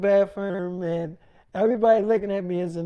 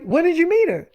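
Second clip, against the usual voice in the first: higher, pressing demand for an answer; demanding question; 8 semitones higher than usual. Retold or imitated. Jealous girlfriend demanding when he met Bella Thorne, higher and insistent. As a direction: push her for the truth.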